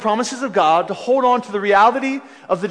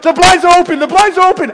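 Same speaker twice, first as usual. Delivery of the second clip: high, loud alarmed repeat; panicked warning; 9 semitones higher, 7 dB louder, faster than usual. Retold or imitated. Friend warning that the blinds are open, alarmed shout. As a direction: cry out in alarm, higher and louder. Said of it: "my friend's like".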